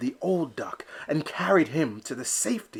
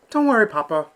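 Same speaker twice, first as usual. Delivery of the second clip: high, louder playful scolding; chiding; 7 semitones higher, 6 dB louder, faster than usual. Acted. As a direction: tease his papa gently. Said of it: "Elmer chided".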